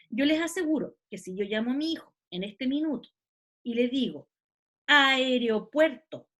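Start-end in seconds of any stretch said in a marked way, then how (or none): 4.9-6.3 "Aeropuerto" stretched slowly, stressed on PUER; slow teacher's "Aeropuerto", leaning on PUER; tonic syllable demo. Shown as a demonstration. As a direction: draw out "Aeropuerto" so a child hears the stress on PUER. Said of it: "dónde está la sílaba tónica".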